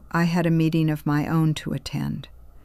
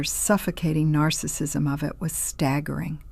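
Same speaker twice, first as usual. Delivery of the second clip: ordinary delivery, nothing marked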